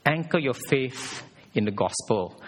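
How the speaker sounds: ordinary talk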